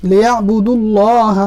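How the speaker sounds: লিয়াবুদুল্লাহ slow, with rounded "lo" in ল্লাহ; heavy rounded Allah. Shown as a demonstration. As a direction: end লিয়াবুদুল্লাহ on a full "dul-looh". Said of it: "ল এর মতো করে". (লিয়াবুদুল্লাহ-র ল্লাহ "ল"-এর মতো মোটা করে)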